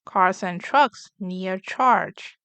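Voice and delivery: ordinary delivery, nothing marked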